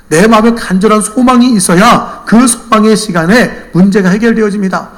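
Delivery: high, loud sermon delivery; impassioned preaching. Real, not acted. Preacher admonishes congregation about not praying, impassioned. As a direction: drive the lesson home at full force.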